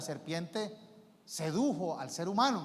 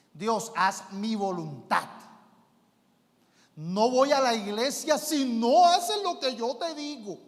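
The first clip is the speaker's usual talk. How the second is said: loud, slow, bossy demand; mock believer bossing God around; demanding blackmailer; 5 semitones higher, 8 dB louder, slower than usual. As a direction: dictate terms to God.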